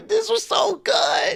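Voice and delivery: high, squealing laugh breaking into tears; laughing and crying. Real, not acted. To his cohost, overcome by the match, laughing through tears. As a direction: laugh till your voice cracks.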